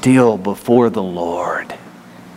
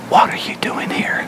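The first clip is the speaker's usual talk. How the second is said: gentle whisper. Shown as a demonstration. God gently asking Elijah in the cave, whispered. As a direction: breathe it like a still small voice. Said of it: "the sound of a gentle whisper".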